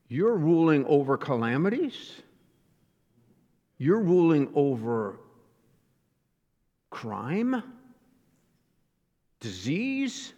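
halting disbelief, long pauses before each item; incredulous questioning. Acted. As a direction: question it as a doubting listener, rising at the end. Acted listener questioning God's rule.

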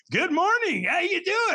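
high-pitched, exuberant greeting; very enthusiastic. Retold or imitated. Sponsor phoning the next morning, very enthusiastic. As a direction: greet like an over-eager, funny friend. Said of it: "very enthusiastic".

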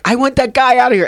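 high, fast, indignant order to bouncers; retold furious demand.

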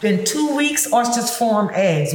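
ordinary talk

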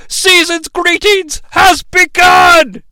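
high, slow booming announcement; slow grandiose declaration; mock villain proclamation. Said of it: "they're like".

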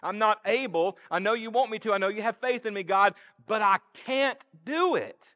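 high, loud, desperate plea; a frightened believer pleading with God; pleading self-doubt. Acted. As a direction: beg God to let you off. Voicing a fearful excuse to God.

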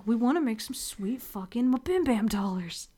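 conspiratorial whisper. Pretend. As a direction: whisper it like a secret. Joking aside about money.

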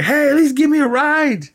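hammed-up, very high-pitched pleading call; Lex Luthor. Podcaster acting Lex left behind by the flyers, high mock pleading. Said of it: "Lex is like".

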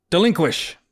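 loud incantation; casting a spell. Acted. Joe casting his first spell at the monkeys. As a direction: call out the spell word.

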